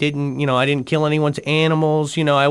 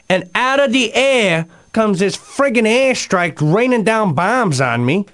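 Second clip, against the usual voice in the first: ordinary talk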